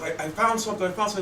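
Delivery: high, eager voice in a joke; a lightly voiced man finding something; excited discovery. Joke teller voicing the boyfriend, high and eager. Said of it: "and he goes".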